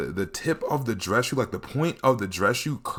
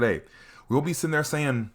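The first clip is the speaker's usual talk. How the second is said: ordinary talk, nothing marked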